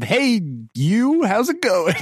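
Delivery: put-on familiar greeting. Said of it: "Did you pull out the old".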